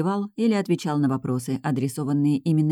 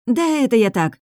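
high, louder hasty dismissal; nervous brush-off; 7 semitones higher, 5 dB louder than usual. A narrator's reading. Girl backtracking before the cook, nervously dismissive. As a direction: wave it away a bit too brightly. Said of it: "Я нервно засмеялась и махнула рукой".

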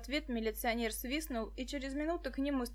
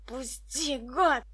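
a light wheeze in a choked cry; mild strangled rasp; wheezing; 6 dB louder, much slower than usual. A narrator's reading. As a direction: croak it out through the noose. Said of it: "хрипел коротышка".

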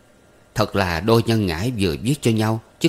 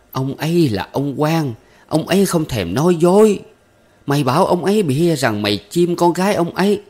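jabbing scold; 8 semitones higher, 5 dB louder than usual. Acted. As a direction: needle him with scorn, climbing high. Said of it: "xỉa xói nói".

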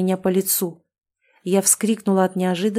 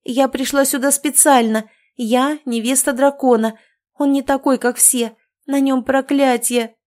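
higher, louder pleading cry; desperate wail; 5 semitones higher, 4 dB louder than usual. Acted. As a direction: blurt it out before they throw her off. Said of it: "я поспешно завопила".

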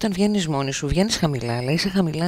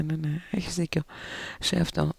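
usual speaking voice

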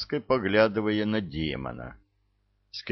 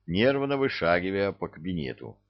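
plain reading voice

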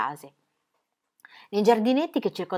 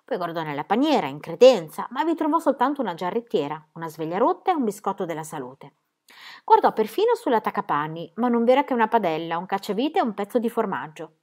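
ordinary delivery

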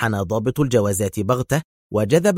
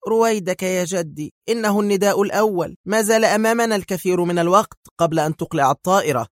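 put-on a lightly raised, sheepish young voice; embarrassed young man; 8 semitones higher than usual. A narrator's reading. Grandson calming his grandfather, sheepish. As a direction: soothe grandpa, a bit embarrassed. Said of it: "بدا الحرج على الشاب وهو يقول".

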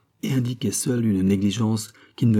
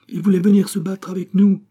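higher, louder eager announcement; eager report; 10 semitones higher, 7 dB louder than usual. A narrator's reading. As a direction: report it with rising excitement. Proudly reports son's wish to fight.

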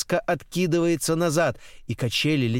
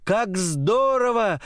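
slow, high, drawn-out exclamation; delighted cry; 6 semitones higher, slower than usual. A narrator's reading. Girl flying on the swing, delighted cry. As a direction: stretch the words out in high delight. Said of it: "кричит она".

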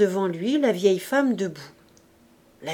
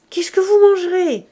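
higher, louder indignant question; exasperated old woman; 9 semitones higher, 10 dB louder than usual. A narrator's reading. The housekeeper demands what he will eat, indignant. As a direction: throw it at him in disbelief.

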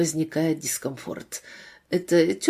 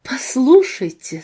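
firm, slightly raised opening, drawn out; restrained threat; 5 semitones higher, 6 dB louder than usual. A narrator's reading. Mother confronting an official over the children, restrained threat. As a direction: press the point without quite threatening. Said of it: "пытаясь не очень угрожать".

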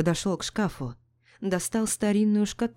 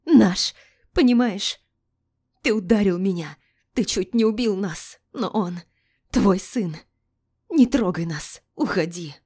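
frantic shouting; 2 semitones higher, 5 dB louder than usual. Acted. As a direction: shout through panic and rage. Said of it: "истерично закричала она".

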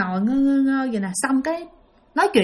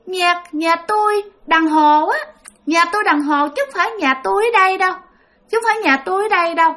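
put-on higher, whiny little-child insisting; pleading child's voice; 7 semitones higher than usual. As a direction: mimic a stubborn small kid, higher. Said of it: "cái giọng của một đứa con nít". (giọng con nít cao, nhõng nhẽo)

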